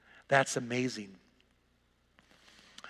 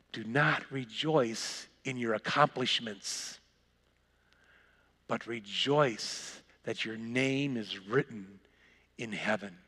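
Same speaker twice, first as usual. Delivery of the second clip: solemn hush; 4 semitones lower, 5 dB quieter, slower than usual. Acted. Jesus warning the joyful disciples, low, soft and slow. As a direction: drop low, soft and slow after the shouting.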